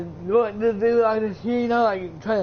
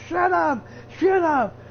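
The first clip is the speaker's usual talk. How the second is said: retold angry shout; 8 semitones higher than usual. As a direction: snap it at the heckling crowd. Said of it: "Like, he's like".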